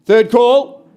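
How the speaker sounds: booming final call; loud auction call. Real, not acted. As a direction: call it out big to the back. Auctioneer's final call after pause.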